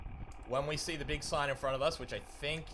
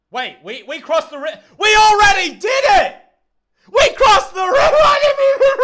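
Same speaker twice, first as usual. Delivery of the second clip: ecstatic high shouting; excited screaming; 19 dB louder, slower than usual. Real, not acted. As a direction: yell it out in total disbelief. Realizes river already crossed.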